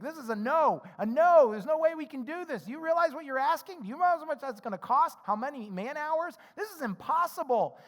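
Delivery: the worrier listing every reason it can't work; panicked bean counter. Retold or imitated. Bean counter objecting to the visionary plan, alarmed and loud. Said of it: "I'm counting all the beans".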